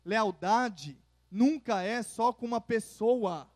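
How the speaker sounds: ordinary talk, nothing marked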